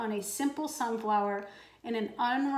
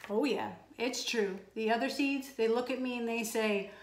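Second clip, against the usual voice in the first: ordinary delivery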